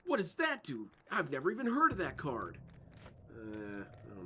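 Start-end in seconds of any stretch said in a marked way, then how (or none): 0.0-2.6 high-pitched mock astonishment; hammed-up disbelief, pitched high; baffled anime duelist. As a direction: act out a startled cartoon duelist. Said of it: "in Yu-Gi-Oh! they would say".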